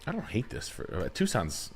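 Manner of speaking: ordinary delivery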